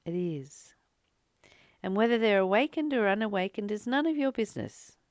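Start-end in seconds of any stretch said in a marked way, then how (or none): 0.0-0.7 a soft breathy whisper; whispered affirmation. Real, not acted. Assuring her listeners that love is everywhere, in a whisper. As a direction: whisper it like a secret truth.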